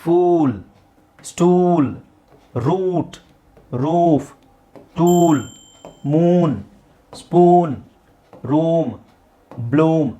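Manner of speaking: each word from ಫೂಲ್ to ಬ್ಲೂಮ್ with a long held oo; drawn-out oo. Shown as a demonstration. Teacher reading the long-sound word list, stretched oo. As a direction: stretch the vowel in ಸ್ಟೂಲ್, ಮೂನ್, ಸ್ಪೂನ್. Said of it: "ಎಳಿಬೇಕು".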